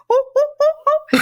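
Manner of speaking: hoo like a playful monkey; monkey hoots. Retold or imitated. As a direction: ape noises to make a baby laugh. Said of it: "I would do this monkey noise".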